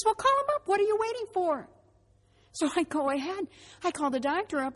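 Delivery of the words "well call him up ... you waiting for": high, loud exasperated prodding; impatient urging. Retold or imitated. Husband urges wife to call the doctor, impatient and high-pitched. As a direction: act out an impatient push to get moving.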